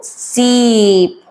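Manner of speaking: सीप with a long drawn-out ee; long vowel demo. Shown as a demonstration. Teacher drawing out the long vowel in सीप. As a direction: stretch the vowel in सीप slowly. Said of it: "stress पड़ रहा है ना letter पे".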